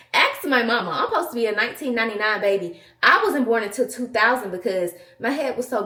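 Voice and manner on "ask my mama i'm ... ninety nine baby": ordinary talk, nothing marked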